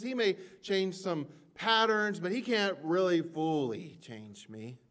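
fervent preacher cadence; preaching at full force. Acted. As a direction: drive the point home from the pulpit. Preaching on redemption.

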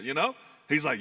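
usual speaking voice

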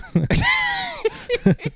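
a high shrieking laugh; loud burst of laughter. Real, not acted. Cohost laughing at the failed accent impression. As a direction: crack up at the accent.

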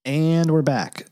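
slow drawn-out host welcome; announcer voice. Acted. Back from break, announcing.

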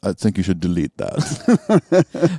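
put-on slow, drawn-out accented old-dad verdict. [name]'s dad reacting to hearing [name], slow accented impression. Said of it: "best impression of [name]'s dad's reaction".

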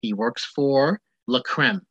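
normal talking voice

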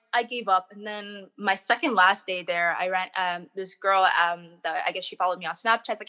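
ordinary talk, nothing marked

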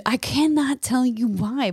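normal talking voice

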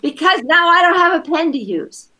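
mild mock child voice; upset kid. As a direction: complain like a wronged little boy. Voicing child explaining hurt.

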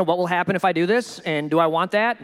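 ordinary talk

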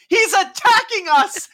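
high, slow panicked cry, laughing; imitating a scared wife, laughing. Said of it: "She goes".

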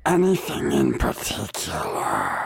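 rasping stage whisper; menacing witch. Acted. Witch challenges the visitors, menacing whisper. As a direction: question them in a creepy hoarse whisper. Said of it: "wow, that, that is menacing".

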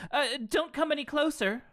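high-pitched fake panic; mock alarmed exclamation. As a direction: squeak it out in hammed-up alarm. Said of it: "exclaimed Alexa".

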